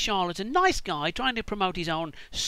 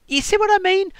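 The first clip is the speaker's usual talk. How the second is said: much higher, louder demand; exasperated emphasis; 13 semitones higher, 7 dB louder than usual. Real, not acted. Comedian pressing his point on the audience, exasperated.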